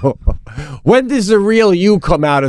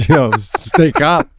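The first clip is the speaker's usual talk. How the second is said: normal talking voice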